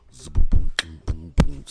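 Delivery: loud mouth-made booms; beatbox a booming car stereo, loudly; imitated bass thump. Demonstrates the booming sound.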